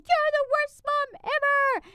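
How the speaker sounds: very high, loud mock tantrum; imitating angry child. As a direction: stretch out a little girl's furious screech. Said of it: "She got mad and pissed".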